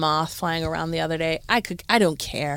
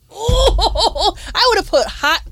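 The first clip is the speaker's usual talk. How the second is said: laughing; 12 semitones higher, 5 dB louder, much slower than usual. Real, not acted. Laughing at the crack story.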